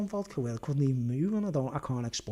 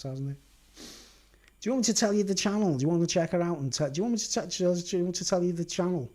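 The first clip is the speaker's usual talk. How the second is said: ordinary talk, nothing marked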